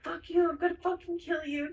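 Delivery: half-held-back pretend screaming; retold scream. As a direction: imitate a furious threat without full volume. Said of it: "Evan is screaming".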